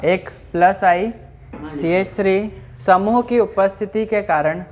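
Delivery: slow, word-by-word dictation with long pauses; classroom dictation. Acted. Teacher reading out notes for students to write, measured dictation. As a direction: dictate in small, evenly stretched chunks.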